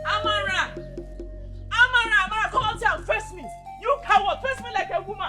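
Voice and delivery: high-pitched furious summons to a rival; angry calling out. Acted. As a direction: yell at her to come out and face you. Woman shouting challenge at rival.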